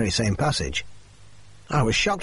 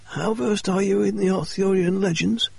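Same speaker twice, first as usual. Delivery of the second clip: much higher, strained voice for Murray; injured man's voice; 9 semitones higher than usual. Acted. Murray quizzing Watson on legends, higher pitched voice. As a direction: voice Murray high and tense. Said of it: "he asked me".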